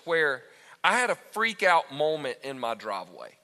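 normal talking voice

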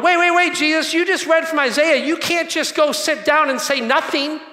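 high, loud urgent protest; protesting crowd. Retold or imitated. Preacher voicing the synagogue crowd protesting to Jesus, high and loud. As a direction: stop him before he sits. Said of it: "they're going".

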